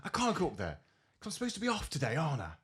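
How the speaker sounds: high-pitched mimicked protest; aggravated. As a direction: act out an exasperated phone argument.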